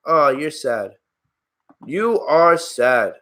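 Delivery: drawn-out, deliberate scorn; slow, pitying put-down. Real, not acted. Streamer putting down a chat troll, slow scorn. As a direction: stretch each word out in disdain.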